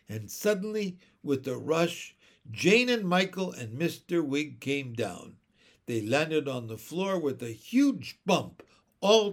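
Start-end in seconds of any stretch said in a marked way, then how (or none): none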